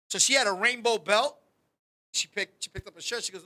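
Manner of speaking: ordinary talk, nothing marked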